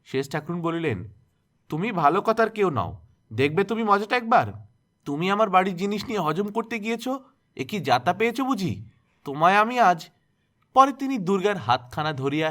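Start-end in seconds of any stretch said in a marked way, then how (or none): 1.7-10.1 higher, louder menacing scold; angry threat. A narrator's reading. Aunt threatening the accused girl, loud rising menace. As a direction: threaten her, voice climbing.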